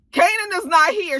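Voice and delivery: high, loud whiny protest; hammed-up nervous Fame; mock panicky refusal. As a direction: squeal it in comic protest. Acts out Fame's panicky refusal.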